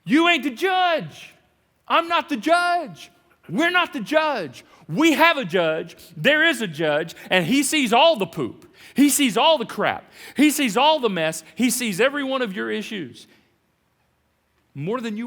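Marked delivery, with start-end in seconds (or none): 0.0-4.6 slow, high, loud hammered denial; emphatic punchline. Acted. Preacher telling the church none of us is judge, slow and high. 4.9-13.3 forceful louder proclamation; loud declaration. Acted. Preacher proclaiming to the church, louder.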